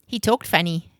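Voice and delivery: ordinary delivery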